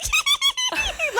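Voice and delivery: very high, gleeful giggle; high-pitched mischievous cackle; little evil laugh. Retold or imitated. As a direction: imitate your own scheming titter. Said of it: "such a little evil".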